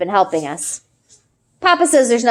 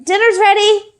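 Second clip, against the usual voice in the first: calling out; 12 semitones higher than usual. A narrator's reading. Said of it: "I call".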